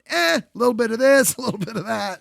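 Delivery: Yiddish-tinged shrug, lightly voiced.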